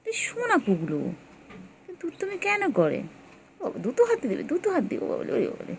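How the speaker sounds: high cooing pet talk. Real, not acted.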